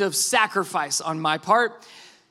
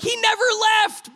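high-pitched incredulous protest; comic exasperation; exasperated retort; 12 semitones higher, 4 dB louder than usual. Retold or imitated. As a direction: cry out at the absurdity. Exasperated reply to son.

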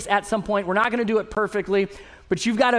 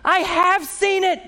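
slow, much higher and louder mock exasperation; wife snapping back at her nervous husband, slow, higher and louder mock exasperation; wife's exasperated reply; 12 semitones higher, 5 dB louder, slower than usual. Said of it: "And she's like".

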